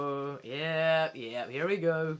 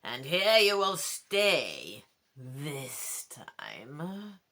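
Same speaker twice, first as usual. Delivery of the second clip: lower, slow, menacing croak; cruel croaking voice; 3 semitones lower, much slower than usual. Acted. Ghost king croaking menace to a frightened boy in the dark. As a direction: croak it like a ghost at your ear. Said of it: "a cruel voice croaks in your ear".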